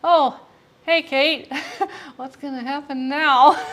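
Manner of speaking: high, laughing mock nervous greeting; imitating nervous friend. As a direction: impersonate him dreading her arrival. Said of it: "He's like".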